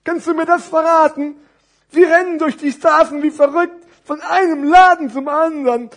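high, loud storybook character; animated puppet-show voice, far above normal pitch; curious big parcel. Acted. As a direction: wonder aloud with exaggerated energy. Thick parcel asks about the fuss.

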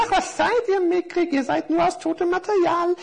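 hohe, prahlerische Figurenstimme; aufgeblasene Kerzen. Acted. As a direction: stolz und herablassend angeben. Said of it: "blusterten sich die Kerzen vor Stolz so auf". (hoch und eingebildet prahlend)

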